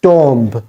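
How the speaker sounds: "tomb" with short o and audible b; "tomb" rhymed with Tom, plus a b; mispronounced tomb. Shown as a demonstration. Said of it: "not tomb".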